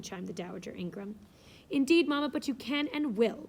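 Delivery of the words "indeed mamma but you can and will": louder, higher and imperious; haughty. A narrator's reading. Blanche overruling her mother, haughty and louder. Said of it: "the haughty voice of Blanche".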